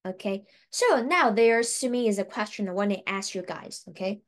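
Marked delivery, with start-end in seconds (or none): none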